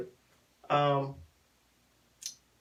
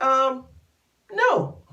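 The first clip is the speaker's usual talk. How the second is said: higher-pitched, hesitant mock refusal; a pause, then a high little "no"; awkward refusal; 6 semitones higher, 4 dB louder than usual. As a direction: imitate a reluctant turn-down.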